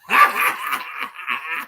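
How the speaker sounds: low mocking laugh that rises at the end; scoffing laugh. Real, not acted. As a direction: laugh at the idea. After mock claim about Brown.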